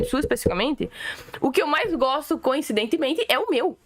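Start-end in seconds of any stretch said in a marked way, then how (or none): none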